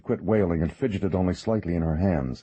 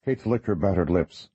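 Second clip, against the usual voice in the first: usual speaking voice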